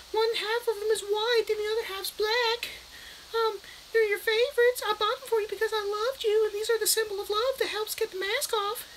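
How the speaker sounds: very high squeaky falsetto mother; sing-song falsetto doting mom; hammed-up sweet mom offering cookies; mock mom voice. Voicing the mom in story.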